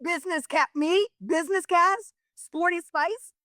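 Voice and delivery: high, disbelieving protest at business casual; high-pitched mock outrage; incredulous. Real, not acted. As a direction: squeak it out, can't believe it. Incredulous at dress code.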